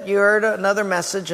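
normal talking voice

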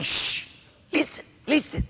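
shush, then high-pitched "listen"; urgent hushing. Retold or imitated. Patient shushing the assistant at the wall, urgent hushing. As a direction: hush someone mid-question.

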